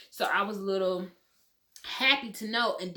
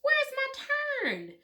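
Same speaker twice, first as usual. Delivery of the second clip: high, slow whining plea; whiny jealous quote; 5 dB louder, much slower than usual. Retold or imitated. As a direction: whine it like a left-out kid.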